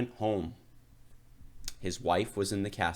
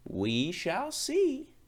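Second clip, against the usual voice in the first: high, slow knowing reply; ominous wife; 13 semitones higher, 3 dB louder, much slower than usual. Acted. Wife answers her hopeful husband, slow high sly warning.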